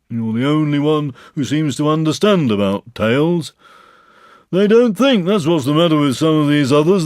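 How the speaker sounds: a put-upon donkey moaning about his tail; Eeyore complaining. Acted. Eeyore complains to the others about his cold tail, gloomy.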